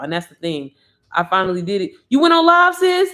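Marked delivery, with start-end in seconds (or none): 2.1-3.1 loud, high excited outburst; too hyped. Real, not acted. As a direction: shriek the question in hyped disbelief. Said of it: "Look, I'm too hyped".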